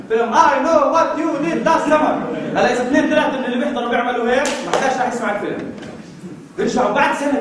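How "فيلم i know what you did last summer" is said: drawn-out title. Pretend. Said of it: "شو بتسوي".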